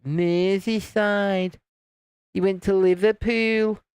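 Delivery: drawn-out, slow Scouse impression; Scouse accent. Shown as a demonstration. Streamer mimicking Liverpool for the chat, put-on Scouse. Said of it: "was that a good Scouse accent?".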